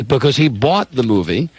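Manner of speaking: usual speaking voice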